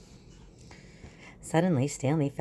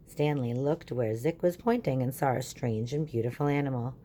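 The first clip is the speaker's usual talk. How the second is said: ordinary talk, nothing marked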